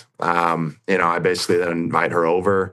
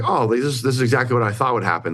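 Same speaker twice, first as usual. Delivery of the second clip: higher-pitched excited recollection; quicker, lifted, mildly thrilled; retold excitement; 6 semitones higher, faster than usual. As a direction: replay your eager, naive excitement in a raised pitch. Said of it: "So I was all excited".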